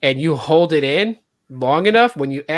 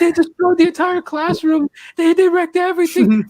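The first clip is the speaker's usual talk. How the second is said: much higher, hysterical teacher pleading with the guard; dramatic panicking teacher; 12 semitones higher than usual. Retold or imitated. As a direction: ham up a frantic teacher, voice way up. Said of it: "she was so dramatic about it".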